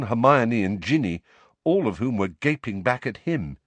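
plain reading voice

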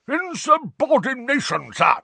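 high, loud roar of outrage; red-nosed wizard roars in protest. Acted. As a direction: bellow at the disobedient portrait. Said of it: "roared a corpulent, red-nosed wizard".

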